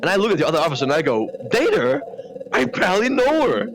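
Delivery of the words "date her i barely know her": high, punchy pun delivery; punchline delivery. Acted. Telling the other officer the pun, pitched high. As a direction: land the pun high and proud.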